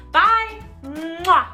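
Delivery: usual speaking voice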